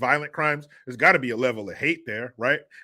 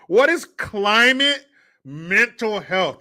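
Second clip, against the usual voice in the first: incredulous; 6 semitones higher, 5 dB louder, slower than usual. Real, not acted. Outraged repeat after laugh.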